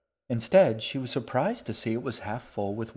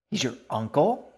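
surprised; 3 semitones higher, 5 dB louder than usual. Acted. Girl shocked by news.

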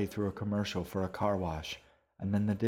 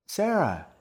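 much higher, loud call of a name; 11 semitones higher, 7 dB louder than usual. A narrator's reading. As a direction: call out happily to her. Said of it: "called out".

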